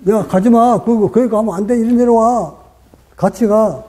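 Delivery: mild mock yell, pitched high. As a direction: imitate a patient calling out to a vision, without real volume. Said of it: "하고 소리 지르는 건". (높은 음으로 가볍게 소리 지르는 흉내)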